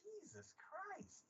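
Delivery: high, slow, soft exclamation; hushed exasperation. Acted. Viewer reacting to brother's slowness, hushed exasperation. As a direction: draw it out under your breath, appalled. Appalled reaction to the retold scene.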